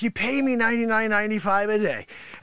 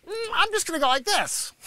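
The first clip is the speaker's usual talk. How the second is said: a lightly voiced, lazy, higher student; mock lazy student; 7 semitones higher than usual. Sensei mocks a careless student with a lightly lazy voice. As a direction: mimic the slacker, lightly. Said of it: "you're like".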